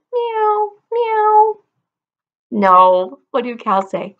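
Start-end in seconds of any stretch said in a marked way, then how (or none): 0.1-1.6 high teasing meow; wrong cat meow. Shown as a demonstration. Teacher teases kids with wrong animal sound. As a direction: meow as a silly wrong answer. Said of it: "And do cows say".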